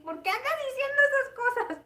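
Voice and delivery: high-pitched mock telling-off from mom; imitated scolding mother. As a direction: scold in a high voice, half amused. Imagines her mother scolding her. (regaño de mamá imitado, voz aguda)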